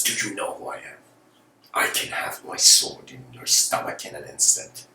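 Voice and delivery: hushed threat, dropping into a whisper; furious warlord threat. Acted. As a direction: threaten up close, half whispered. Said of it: "furious warlord".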